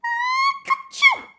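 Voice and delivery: a sharp high sneeze sound. Acted. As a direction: burst out with a little rabbit sneeze. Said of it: "Peter sneezed".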